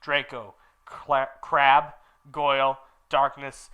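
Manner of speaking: ordinary delivery, nothing marked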